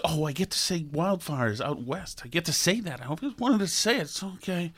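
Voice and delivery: smug little hush, savouring the words; mocking hushed glee. Host mocks people eager to say wildfires, hushed and gleeful. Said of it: "so pathetic".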